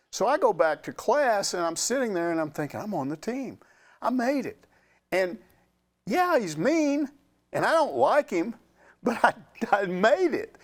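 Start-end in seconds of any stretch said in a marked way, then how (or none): 2.8-10.5 higher, thrilled relief; boy realizing he made the team, thrilled; excited. Retold or imitated. Boy's inner relief.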